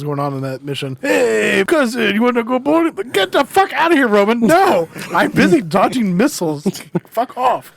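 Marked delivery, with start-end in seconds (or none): 1.0-2.9 higher, put-on Roman voice; sing-song cousin nagging; imitating Roman. Player recalling Roman calling mid-mission, mimicking him. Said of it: "Said it earlier". 3.1-7.7 higher, louder mock outrage; mock angry yell. Player yelling back at Roman, mock fury.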